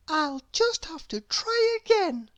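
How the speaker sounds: small, high thinking voice; high little voice; tiny character voice. A narrator's reading. Mister Quiet's thought in tiny voice.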